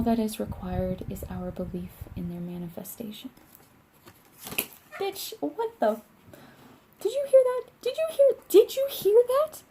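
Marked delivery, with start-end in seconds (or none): 5.0-9.6 high, loud, startled disbelief; sudden high alarmed outburst. Real, not acted. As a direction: squeal it out in shock at the sound.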